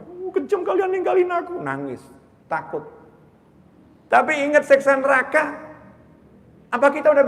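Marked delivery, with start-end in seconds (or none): 0.0-1.6 very high-pitched whiny reproach; mock angry complaint. Scared person scolding friends who ran off, mock angry complaint. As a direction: protest like someone abandoned by friends. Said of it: "Marah sama temennya".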